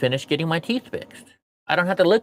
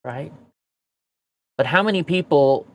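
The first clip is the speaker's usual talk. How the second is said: normal talking voice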